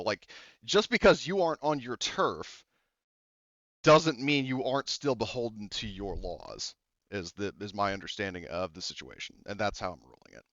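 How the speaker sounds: usual speaking voice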